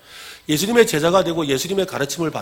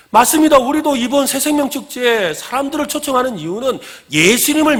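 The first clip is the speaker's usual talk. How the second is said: high, loud sermon delivery; impassioned preaching; 8 semitones higher, 7 dB louder than usual. Real, not acted. Preacher urging the church to welcome people, impassioned preaching. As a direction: drive it home to the congregation. Preaches at full force to congregation.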